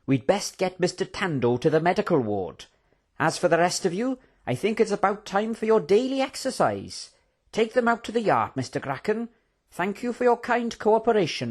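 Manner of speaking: high-pitched, mock-courteous villain voice; smug villain. Baracus dismissing the prisoners, high and mock-courteous. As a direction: lilt upward with false politeness.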